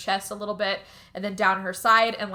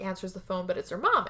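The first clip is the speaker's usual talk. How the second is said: usual speaking voice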